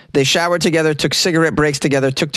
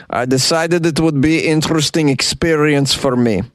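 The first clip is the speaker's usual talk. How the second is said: put-on a light Ukrainian accent for the husband; quoting him in a mock Ukrainian accent; 3 semitones higher than usual. Husband explaining his handcuff idea, lightly Ukrainian-accented. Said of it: "I get to do a Ukrainian accent".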